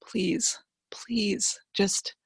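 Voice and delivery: hushed pleading whisper, breath only on the pleases; whispered prayer. Retold or imitated. Widow praying over her dying husband, whispering. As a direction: whisper the plea to God over his sickbed. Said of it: "I'm praying over him and I'm crying".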